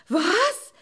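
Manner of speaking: high, loud startled shriek; alarmed cry. A narrator's reading. Mother doe hearing of the bear, alarmed cry. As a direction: cry out in shock. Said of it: "rief das Reh erschrocken".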